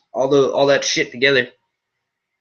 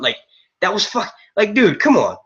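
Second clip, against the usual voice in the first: normal talking voice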